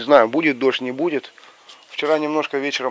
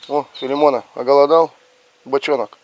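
calling the dogs; 3 semitones higher, 9 dB louder than usual. Real, not acted.

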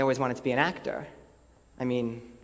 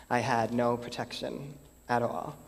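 ordinary talk, nothing marked

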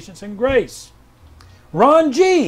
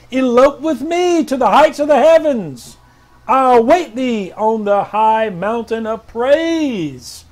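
higher, louder, commanding declaration; prophetic proclamation; 4 semitones higher, 5 dB louder, slower than usual. Acted. Pastor reading R J W's word, loud and commanding. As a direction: thunder it out like a prophet.

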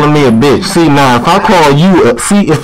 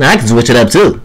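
dropped, lower-pitched take on the line; switched-up voice; 7 semitones lower than usual. Shown as a demonstration. Showing the other caller they can switch it up, lower voice. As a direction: bring the pitch well down. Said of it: "I can switch it up a lot".

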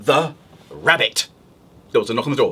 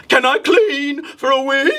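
higher, louder eager wolf; wolf asking; 8 semitones higher, 4 dB louder than usual. Acted. Wolf at the witch's door, eager asking. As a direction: ask hopefully, like a keen wolf. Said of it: "the wolf said".

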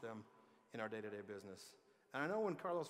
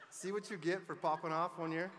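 loud, raised-pitch imitation of gloating fans; mock fan taunt; 5 semitones higher, 7 dB louder than usual. Speaker voicing Ute fans' jab at the audience, mock taunt. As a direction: crow it like a rival fan.

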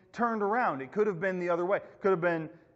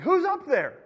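high, raised call to someone above; 10 semitones higher, 5 dB louder than usual. Acted. Dangling man calls to a voice above, calling up. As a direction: holler up the cliff.

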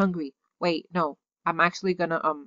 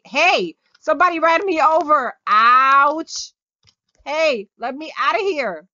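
louder, higher, mock-offended cry of hey and ouch; mock outraged yelp; 6 semitones higher, 5 dB louder than usual. Real, not acted. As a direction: yelp it as if bumped by a car in the game. Run over by another player in game.

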